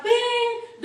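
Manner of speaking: high-pitched mimicked "ping"; imitated chime. Speaker mimicking the paging chime she heard, high imitated ping. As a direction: ring out a high ping like an intercom tone.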